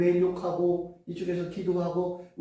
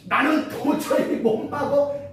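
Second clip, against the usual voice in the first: exasperated self; 8 semitones higher, slower than usual. Retold or imitated. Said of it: "이렇게 하는 것도 내가 하는 거잖아".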